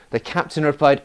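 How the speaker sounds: ordinary talk, nothing marked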